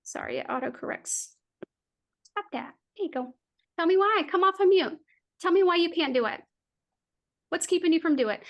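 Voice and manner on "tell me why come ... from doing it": higher, louder, pushing the crowd; loud challenge. Real, not acted. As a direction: demand an answer like a coach. Host calling out to chat.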